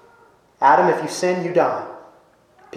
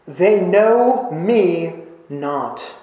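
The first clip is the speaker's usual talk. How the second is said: slow lament; much slower than usual. Acted. God's lament read from Jeremiah.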